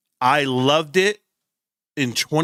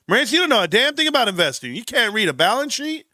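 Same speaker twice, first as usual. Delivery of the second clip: 1 mocking critics; 7 semitones higher, faster than usual. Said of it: "everybody coming over to the show telling me".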